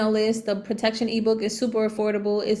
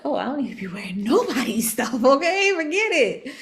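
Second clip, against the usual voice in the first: a mocking dismissive comeback, laughing; retorting; 4 semitones higher than usual. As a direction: brush it off with attitude. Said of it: "I was like".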